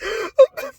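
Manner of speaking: high-pitched burst of real laughter. Real, not acted. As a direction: crack up helplessly at the absurdity. Cracks up at absurd quote.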